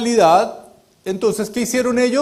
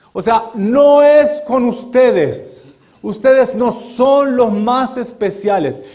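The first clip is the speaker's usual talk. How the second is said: louder, slow and deliberate, "eres" pressed; emphatic; 4 semitones higher, 7 dB louder, slower than usual. Real, not acted. Preacher to congregation, driving the point home, loud and slow. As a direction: hammer each word home slowly.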